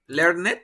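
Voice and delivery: "learned" in two syllables, learn-et; wrong extra syllable. Shown as a demonstration. Teacher testing students with the mistake, "learned" as learn-et. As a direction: sound out the E in "learned". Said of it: "¿Será posible pronunciar learned?".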